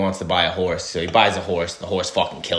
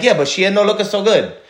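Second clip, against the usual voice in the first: put-on high, loud Italian accent on the repeated line; 11 semitones higher, 5 dB louder than usual. Italian seller repeats his warning in accent. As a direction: lean into the Italian punchline. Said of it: "I like your Italiano voice".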